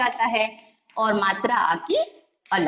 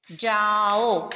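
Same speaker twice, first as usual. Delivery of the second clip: drawn out; 4 semitones lower, much slower than usual. Shown as a demonstration. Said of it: "जाओ किस तरह से इसमें".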